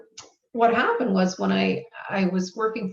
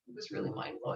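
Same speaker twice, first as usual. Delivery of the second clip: awed whisper; 9 dB quieter, faster than usual. Real, not acted. Awed recollection of success.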